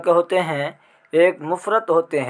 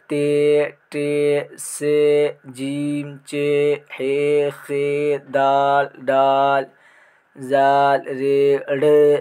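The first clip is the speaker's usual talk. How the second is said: alphabet recitation; much slower than usual. Shown as a demonstration. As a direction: recite the letters one by one for the class. Teacher reciting the alphabet aloud.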